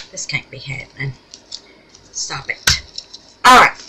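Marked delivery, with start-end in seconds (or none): 0.1-2.8 unvoiced, half-despairing under breath; exasperated whisper. Real, not acted. Glove stuck, mild panic.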